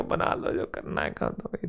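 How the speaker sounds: very soft, near-whispered quote; hushed retold surrender. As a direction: mimic giving in under your breath. Retold bracing for his tattoo. (दबी आवाज़ में, लगभग फुसफुसाकर)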